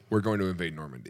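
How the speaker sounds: low, very soft, quick aside; deadpan bluff. Acted. Looking the enemy in the eye to announce an invasion, deadpan. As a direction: state it quietly, giving nothing away.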